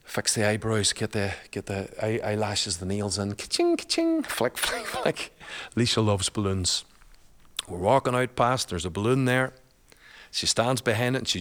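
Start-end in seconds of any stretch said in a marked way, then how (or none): 3.3-5.3 very high mock cash-register ka-ching then flicks. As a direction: mimic the girls scrolling, playful and high. Mocking phone-scrolling teen girls.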